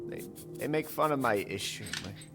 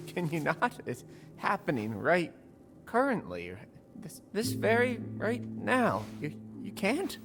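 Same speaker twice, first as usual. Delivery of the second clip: high, halting, flustered protest; anxious stammer. Acted. Nervous recruit defending their stutter to a mentor, high halting stammer. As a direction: stumble through it, high and unsure. Game character stammering, self-conscious about stutter.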